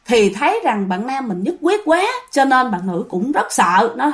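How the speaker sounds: plain reading voice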